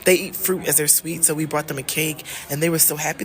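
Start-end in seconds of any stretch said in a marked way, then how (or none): none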